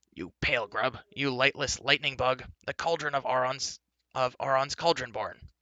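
impatient, forceful rebuke; scornful scolding. A narrator's reading. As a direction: berate him with exasperation. Insults thrown at Gwistel.

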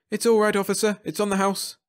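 hurried, high-pitched reassurance; hasty. A narrator's reading. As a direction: placate the officer in a hurry. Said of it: "Jeb said hastily".

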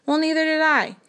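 indignant retort. A narrator's reading. Mother retorts.

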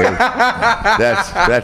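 high, slow, laughing through it; cracking up at the joke; giggling delivery. Real, not acted.

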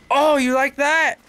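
high, loud, slow gloating taunt. Real, not acted. Gamer taunts an enemy he just shot, gloating. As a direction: rub it in, slowly.

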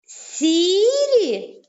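СІРІ with the first І held long and high; stress on СІ. Shown as a demonstration. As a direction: call out СІ in СІРІ, then fall on РІ. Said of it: "буде падати наголос". (СІРІ, високо протягуючи наголошений перший склад)

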